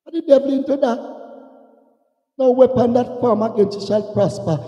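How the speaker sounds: ordinary talk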